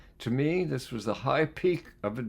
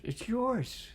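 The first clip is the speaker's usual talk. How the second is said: put-on small girl's voice; 11 semitones higher, 4 dB quieter than usual. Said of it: "She shook her head and answered".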